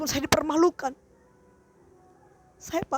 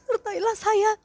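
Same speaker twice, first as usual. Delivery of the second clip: crying prayer; 8 semitones higher, 6 dB louder, slower than usual. Real, not acted. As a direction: voice breaking into tears.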